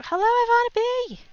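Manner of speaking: high, loud singsong hello; big high-pitched welcome; excited greeting. Real, not acted. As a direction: call out to a friend just arriving. Greeting an arriving viewer.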